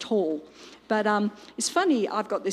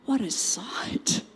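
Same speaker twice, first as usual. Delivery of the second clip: hushed reaction; 2 semitones lower, 6 dB quieter, slower than usual. Acted. Preacher beholds the bodies covered in skin, hushed whisper. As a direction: whisper it slowly, letting it sink in.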